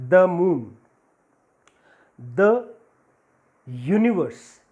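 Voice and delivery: each "the" said "thuh"; short schwa in "the" before moon and universe. Shown as a demonstration. Teacher gives consonant examples to students, "the" said "thuh". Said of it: "because ya is also consonant".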